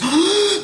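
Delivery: sharp high gasp of fake alarm; hammed-up startled gasp; mock fearful gasp. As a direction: gasp like you've seen danger at the door. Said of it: "he goes".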